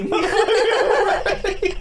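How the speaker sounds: laughing. Retold or imitated. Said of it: "the guy that said".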